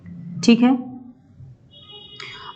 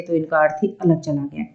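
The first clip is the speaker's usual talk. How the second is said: ordinary delivery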